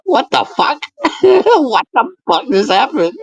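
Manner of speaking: mock shocked squeal at a botched ring entrance; retold shock. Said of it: "and I'm like".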